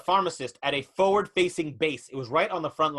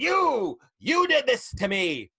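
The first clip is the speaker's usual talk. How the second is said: accusing; 8 semitones higher, 4 dB louder, much slower than usual. Acted. Deformed man accuses pharmacist.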